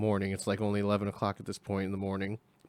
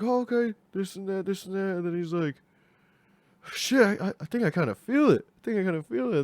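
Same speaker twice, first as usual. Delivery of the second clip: high buddy voice; 9 semitones higher than usual. Retold or imitated.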